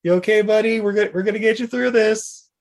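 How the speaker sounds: concerned hero calling to his dazed friend; Hawk's voice. Retold or imitated. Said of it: "Hawk is like".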